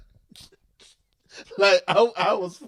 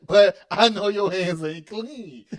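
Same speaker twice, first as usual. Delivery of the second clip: knowing dad; 4 semitones higher, 9 dB louder, slower than usual. Retold or imitated. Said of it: "I said".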